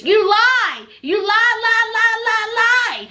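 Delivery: loud, high sing-song taunt of lie; retold accusing sing-song shout; mock taunting yell. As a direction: chant lie lie at him like a schoolyard taunt. Memphis shouting at Hamza.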